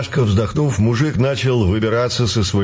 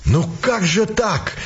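cried out; 7 semitones higher, slower than usual. A narrator's reading. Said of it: "вскричал вдруг мужик".

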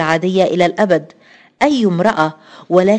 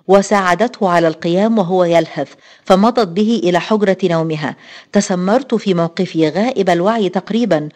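usual speaking voice

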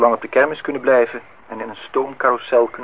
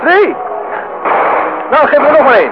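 high, loud calls to the stall keeper; loud, eager calls across the noisy stall; calling out; 7 dB louder than usual. Acted. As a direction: shout to be heard over the fair. Shouting over fairground noise.

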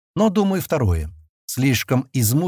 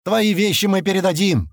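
calling out; 8 semitones higher than usual. A narrator's reading. Man calling from the tent about belongings, mild shout. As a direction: call it across, lightly raised. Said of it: "крикнул от палатки другой мужик".

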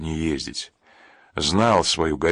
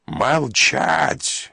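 drawn-out, higher-pitched bark; barked command; 7 semitones higher than usual. A narrator's reading. Duty officer silencing a crowd of shouting women, barking. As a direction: cut through the din with a raised command. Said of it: "рявкнул дежурный Акулов".

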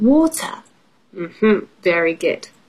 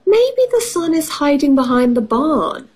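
higher-pitched cow's suggestion; lightly voiced cow, starting high; storybook cow; 6 semitones higher than usual. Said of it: "said cow".

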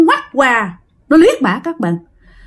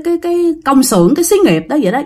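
ordinary delivery

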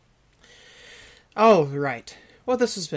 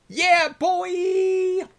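high, loud whoop; a big hammed-up victory cry; triumphant shout; 6 dB louder than usual. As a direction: yell it out in triumph. Quoting a triumphant movie line.

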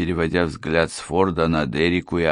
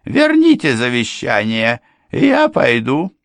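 housekeeper's demand; 7 semitones higher, 6 dB louder than usual. A narrator's reading. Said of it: "housekeeper demands will back".

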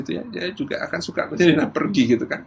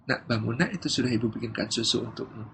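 soft, lower wake-up; gentle mother; 4 semitones lower, 10 dB quieter than usual. Acted. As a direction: quietly rouse a child with reassurance. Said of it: "Beda dengan yang kedua". (ibu membangunkan dengan suara lembut)

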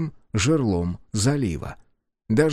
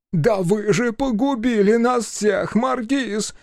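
high, slow outraged yell; 10 semitones higher, slower than usual. Acted. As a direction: shriek the accusation. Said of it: "заорал Киатон".

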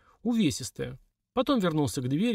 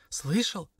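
mild higher exclamation; exclaiming; 5 semitones higher than usual. A narrator's reading. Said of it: "воскликнула Лилька". (лёгкое восклицание)

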